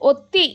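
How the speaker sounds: ఒత్తి pitched high with extra force; pressed word. Shown as a demonstration. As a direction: hit ఒత్తి high and hard. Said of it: "ఒత్తి పలుకుతాం కదా".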